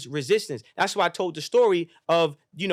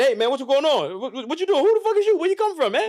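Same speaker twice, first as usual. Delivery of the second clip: high, louder, half-laughing bewildered voice; startled younger self; 10 semitones higher, 5 dB louder than usual. Retold or imitated. Young man meets his older self, high bewildered cries. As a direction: play the scared kid questioning a stranger.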